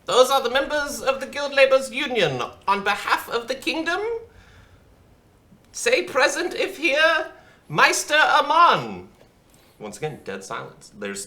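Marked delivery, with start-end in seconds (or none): 0.0-9.1 much higher, louder formal announcer voice; Bushel calling roll. Acted. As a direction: call the names out to the hall.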